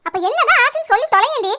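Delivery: loud, swooping scolding; fed up, pushing for answers; exasperated demand. Acted.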